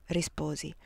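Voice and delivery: ordinary delivery, nothing marked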